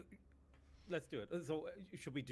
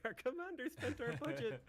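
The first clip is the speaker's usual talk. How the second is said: very high squeaky complaining grunt; squeaky soldier voice. Retold or imitated. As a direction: whine it like a tiny soldier. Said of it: "It's like".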